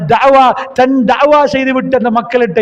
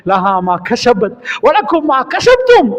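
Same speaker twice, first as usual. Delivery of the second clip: slow, chanted Arabic verse; reciting an Arabic verse in the sermon, slow and chanted; Quranic recitation; 3 semitones higher, slower than usual. Acted. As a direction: intone the verse slowly.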